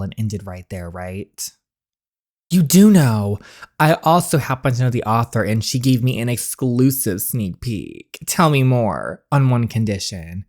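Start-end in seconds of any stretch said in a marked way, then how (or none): none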